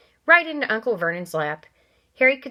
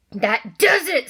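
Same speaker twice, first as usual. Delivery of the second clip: forced calm; 7 semitones higher, 6 dB louder, slower than usual. Acted. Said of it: "trying to speak calmly".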